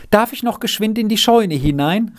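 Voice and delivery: put-on boy calling eagerly to the farmer's wife; called out. A narrator's reading. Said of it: "rief er ihr zu".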